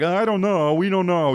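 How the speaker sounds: high, doubtful theater staff; hesitant staff. Retold or imitated. Fargo theater staff hesitating about booking him, high doubtful voice. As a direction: hedge like unsure venue staff.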